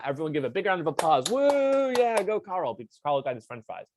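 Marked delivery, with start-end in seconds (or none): 1.3-2.7 high, excited little whoop; mock cheer. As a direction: cheer for Carl like a classroom hype-man. Cheering Carl's fries.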